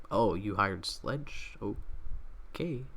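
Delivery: low, slow, trailing-off acted reaction; underwhelmed indifference. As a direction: play it mildly puzzled and unimpressed. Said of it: "It was just like".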